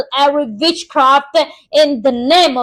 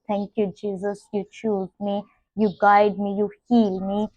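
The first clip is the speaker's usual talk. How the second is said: lower, softer intimate prayer; 6 semitones lower, 3 dB quieter than usual. Real, not acted. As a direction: speak gently, close to God. Soft intimate prayer over music.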